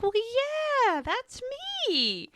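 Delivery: high, eager imitation of Bert; excited Bert. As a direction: play him delighted to be recognized. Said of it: "He gets so excited".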